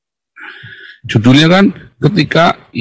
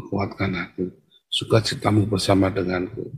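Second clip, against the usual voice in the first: soft, low-pitched plea; quiet prayer; 5 semitones lower, 11 dB quieter than usual. Acted. Tired believer asking God for strength, quiet prayer. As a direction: pray it low and hushed.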